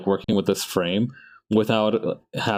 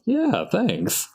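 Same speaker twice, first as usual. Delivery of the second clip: much higher pitched, mock-bashful thank-you; 12 semitones higher than usual. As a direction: pop the voice way up on a coy thanks. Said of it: "I'm like".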